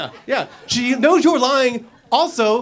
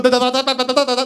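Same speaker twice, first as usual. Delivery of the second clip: higher, panicked stammering nonsense; mock fearful babble; 5 semitones higher, much faster than usual. Man asked where he was, mock panicked babble. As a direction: babble like a caught liar. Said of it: "that's fear and respect".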